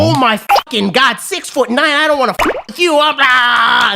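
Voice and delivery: imitating a raving stranger, high and running into babble; coked-out crazy. Stranger freaking out over the man's height, coked-out. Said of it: "he was just going fucking crazy".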